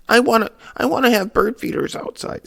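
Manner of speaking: high, whiny mocking voice; mock childish demand, far above your own pitch; sarcastic mimicry. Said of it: "being super sarcastic about the thing".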